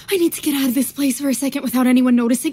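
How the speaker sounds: ordinary talk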